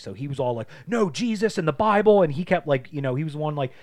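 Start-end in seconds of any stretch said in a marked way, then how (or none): 0.9-2.2 loud, high mock-preachy retort; imitating an indignant believer, raised and emphatic; mock righteous protest. Retelling the Haitian kid arguing religion in class, righteous protest.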